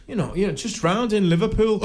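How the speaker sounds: a fan trying too hard to sound refined; nervous fake posh. An actor telling Souness where he filmed, in fake posh. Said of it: "I tried to pretend I was posh".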